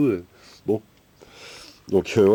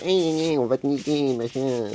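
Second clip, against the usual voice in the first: high sneering thug voice, much higher; taunting street-thug threat; gangster voice; 7 semitones higher than usual. Retold or imitated. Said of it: "ils ont une petite voix de gangster".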